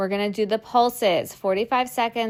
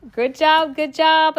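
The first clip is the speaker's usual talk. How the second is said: excited praise; 7 semitones higher, 8 dB louder than usual. Real, not acted. Coach cheers after hard set.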